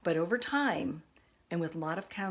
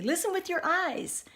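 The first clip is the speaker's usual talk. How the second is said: put-on child's voice; 12 semitones higher, 4 dB louder than usual. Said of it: "they'd say".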